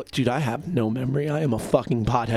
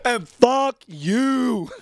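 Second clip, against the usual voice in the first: higher, louder, slow sing-song jeer; drawn-out taunt; 9 semitones higher, 4 dB louder, much slower than usual. Real, not acted. Host taunting his absent friend Sean, a slow sing-song jeer. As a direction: stretch it out to rub it in.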